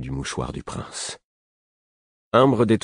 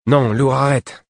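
"Non, Lourd, arrête" higher and louder, sharp; "Non, Lourd, arrête" as an urgent higher, louder command; urgent stop; 8 semitones higher, 6 dB louder than usual. Acted.

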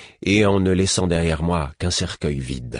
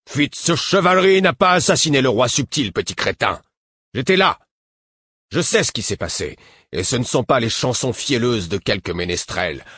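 vieux guerrier indigné, plus aigu et fort; défends ton roi avec colère; défense indignée; 6 semitones higher than usual. A narrator's reading. Vieux garde défend Fitz. (vieux guerrier indigné)

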